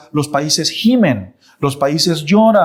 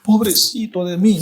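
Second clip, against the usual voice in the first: put-on whiny child; 4 semitones higher than usual. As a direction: play a child about to cry. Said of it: "como un niño que se va a poner a llorar".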